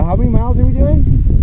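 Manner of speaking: excited. Real, not acted. Hyping the run plan.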